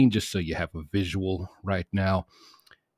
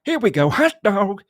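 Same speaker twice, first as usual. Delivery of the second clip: much higher and louder excited whoop; imitating dad's excited cheer; 13 semitones higher, 9 dB louder than usual. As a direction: yell it like a dad thrilled by a dunk. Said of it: "Michael Jordan goes up and makes a dunk".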